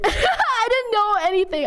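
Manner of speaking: high, half laughing, half crying; laughing through tears. Real, not acted. Streamer recalling first grade to chat, laughing through tears. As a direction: let it break into a teary laugh. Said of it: "I'm tearing up".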